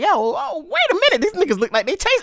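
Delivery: sudden dawning panic, voice jumping up; retold startled yelp; alarmed realization. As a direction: pitch climbing high in alarm. Realizing the birds will chase.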